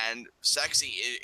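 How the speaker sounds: normal talking voice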